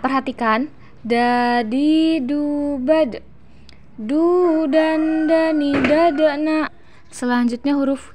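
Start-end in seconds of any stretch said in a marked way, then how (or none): 1.1-3.2 slow, drawn-out "Dadi dubad"; chanted "Dadi dubad" with echoing final stop; bouncing d. Shown as a demonstration. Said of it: "terdengar suaranya memantul". 3.9-6.7 slow chanted syllable drill; slow recitation model; drawn-out demonstration. Shown as a demonstration. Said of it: "terdengar suaranya memantul".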